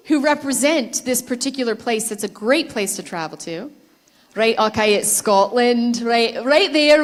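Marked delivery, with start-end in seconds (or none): 4.3-7.0 light put-on Scottish accent, louder; hammy Scottish accent rolling the r in right; playful Scottish accent calling out to the crowd. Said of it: "fantastic Scottish accents".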